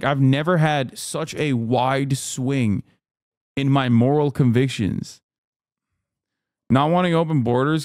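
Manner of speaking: ordinary delivery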